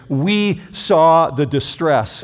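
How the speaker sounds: WE leaned on, slow and deliberate; slow down and press on "We saw the distress"; weighty, drawn-out We at the front; stress on we. Shown as a demonstration. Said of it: "just bear down with me on this phrase".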